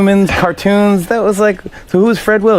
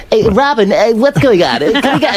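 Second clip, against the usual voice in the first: higher, younger-sounding voice; mock old Howard, pitched up; 7 semitones higher than usual. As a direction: imitate his old high-pitched radio voice. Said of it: "your voice is totally different".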